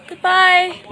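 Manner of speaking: a loud farewell called across the field; calling a big goodbye to the lambs. Real, not acted. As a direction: shout goodbye to the lambs as you walk off.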